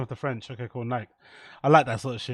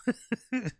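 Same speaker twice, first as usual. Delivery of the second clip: short, soft, high giggle; 10 semitones higher, 8 dB quieter than usual. Real, not acted. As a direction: let out a quiet high giggle. Laughing at his ban joke.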